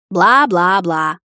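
very slow, drawn-out mockery; mocking dismissal. A narrator's reading. Bride dismissing the captain's scolding, mockingly. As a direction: drag out each syllable, bored and mocking. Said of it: "закатила я глаза".

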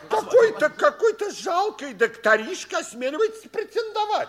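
high indignant outburst; outraged. Acted. Forsythe rages at the upstart doctor, high-pitched outrage. Outraged old man quarrel.

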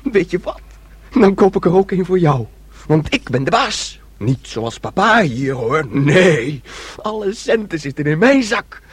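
loud, high, gleeful daydream; carried away by the fantasy; excited boasting. Acted. Son boasting to his sister about the cars he'll buy.

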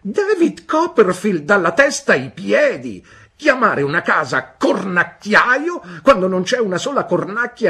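the aunt rails against the late Mr Copperfield; scornful shouting. A narrator's reading. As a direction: rant at the dead man's folly. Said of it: "gridò Miss Betsy".

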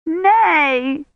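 put-on high, drawn-out child's wail; distressed high-pitched cry. A narrator's reading. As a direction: wail in protest like a little girl. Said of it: "Agnes wailed".

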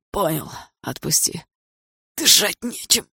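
strained voice, short of air; choked. A narrator's reading. Andrei begging with an elbow at his throat, choked. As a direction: squeeze the words out as if throttled. Pinned by throat, cannot breathe.

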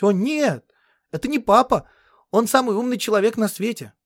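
put-on high, loud girlish indignation; indignant girl. A narrator's reading. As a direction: protest hotly in a high girl's voice. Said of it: "Она возмутилась". (громкое девичье возмущение)

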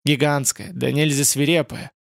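ordinary delivery, nothing marked

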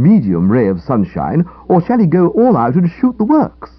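earnest, worked-up account of a blunder; aggrieved young man, explaining. Acted. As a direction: lay out the sorry tale with conviction. Character explains his mistake at length.

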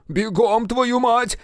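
high-pitched frantic command; urgent cursing yell. Acted. Engineer rushes woman past her objection, urgent yell. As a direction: drive her on with a high shout.

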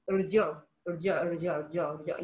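low, soft ارجعوا begun on bare r, "rji'u"; starting on silent letter. Shown as a demonstration. Teacher removes waw and tries starting, bare consonant onset. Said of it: "أشيل الواو وأبدأ".

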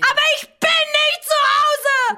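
sehr hoch, laut, gedehnt kreischend; hysterischer Aufschrei. Acted. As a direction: schrill hinausschreien. Hysterischer Ausbruch. (hoher, gedehnter Schrei)

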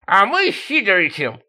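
high, loud put-on squeal; high-pitched comic bragging; squealing diver. A narrator's reading. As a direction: squeal it in a silly character voice. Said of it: "завизжал водолаз".

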